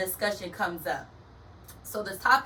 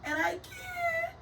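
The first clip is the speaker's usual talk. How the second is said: laughing squeal; 6 dB quieter, slower than usual. Real, not acted. Laughing at her poor eyesight.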